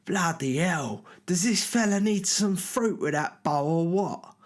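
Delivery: high mocking voice of a cocky promoter; promoter's jeer. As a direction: sneer it like a loudmouth showing off. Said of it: "and he's like".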